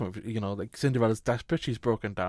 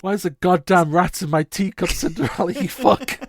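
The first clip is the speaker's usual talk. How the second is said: raised, higher comic outrage; mock furious king; 5 semitones higher, 4 dB louder than usual. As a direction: rant as an exasperated king. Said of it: "You'd be furious".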